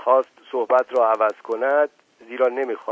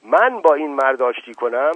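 louder, harsh indignant question; harsh and sharp; 5 semitones higher, 9 dB louder than usual. A narrator's reading. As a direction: snap it back in outrage. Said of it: "آنچنان خشونت و تندی در این سؤال".

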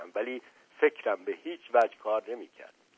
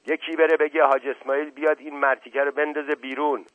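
loud, higher furious roar; bellowing; 5 semitones higher, 5 dB louder than usual. A narrator's reading. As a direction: roar for the janitor. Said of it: "نعره زد".

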